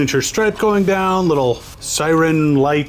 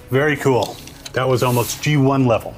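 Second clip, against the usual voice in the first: usual speaking voice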